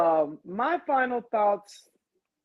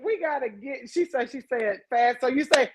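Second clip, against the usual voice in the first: ordinary talk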